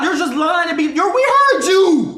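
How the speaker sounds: loud, high, drawn-out call-out; shouting her down. Real, not acted. Streamer shouting at a girl caught lying in the clip. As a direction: yell it like you caught a liar.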